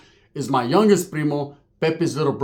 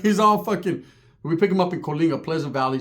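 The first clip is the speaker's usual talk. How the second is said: ordinary talk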